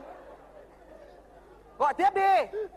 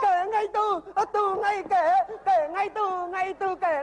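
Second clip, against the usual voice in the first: panicked babble; 6 semitones higher, 3 dB louder, slower than usual. Acted. Panicked garbled words.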